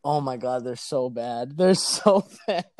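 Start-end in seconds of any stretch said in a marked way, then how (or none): 1.6-2.7 cracking up mid-sentence; real amused laughter through the line; laughing. Real, not acted. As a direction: let laughter break up the words. Cracking up at own messages.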